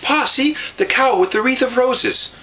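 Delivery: high, eager voice; excited child. A narrator's reading. Girl pointing the cow out to Pa, excited. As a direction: bubble over with delight.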